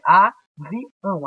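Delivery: "avião" split as a, vi, ão; spaced-out "avião", each syllable distinct. Shown as a demonstration. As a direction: pause between the pieces of "avião". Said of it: "a separação".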